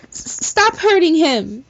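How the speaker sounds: high, loud stammered plea; high loud desperate cry. Acted. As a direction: yell at the bullies through tears. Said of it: "I cried in front of all of them".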